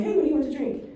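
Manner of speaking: chirpy server asking for drinks; waiter voice. Retold or imitated. Waiter greeting loudly in noisy restaurant.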